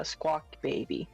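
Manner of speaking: ordinary talk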